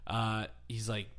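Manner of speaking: ordinary delivery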